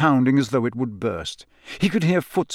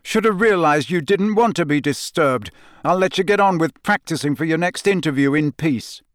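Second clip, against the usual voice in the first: louder, bitter and mocking; angry sarcasm; 6 semitones higher, 3 dB louder than usual. A narrator's reading. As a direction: throw it back at him. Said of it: "his face reddening with anger".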